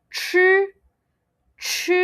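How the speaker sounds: ch with the tongue pulled back; retroflex ch. Shown as a demonstration. Teacher demonstrating retroflex ch sound. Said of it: "your tongue will be a bit at the back".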